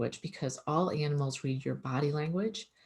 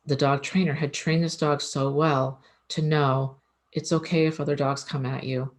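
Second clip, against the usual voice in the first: ordinary delivery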